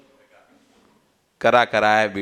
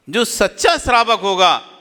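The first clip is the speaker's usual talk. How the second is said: raised, forceful preaching voice; emphatic repeat; 7 semitones higher, 4 dB louder than usual. Real, not acted. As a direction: drive the point home, pitched up. (ज़ोर देकर, ऊँचे स्वर में)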